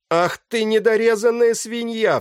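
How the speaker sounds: very high, loud cry of abuse; outcry. A narrator's reading. Captain cursing his attacker, crying out high and loud. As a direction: scream the insult with hate and fear. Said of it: "вскрикнул капитан Мизович".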